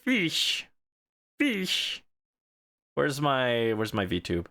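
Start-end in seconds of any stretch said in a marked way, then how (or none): 0.0-2.0 warbly underwater voice filter; fish voice morph. Real, not acted. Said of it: "Thank you for the fish redeem morph".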